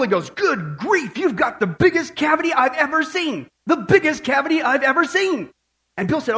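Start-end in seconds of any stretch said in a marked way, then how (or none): 0.3-5.5 high-pitched mock exclamation of a shocked dentist; mock shout. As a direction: imitate a dentist crying out, with swooping pitch. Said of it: "he shouted to Bill".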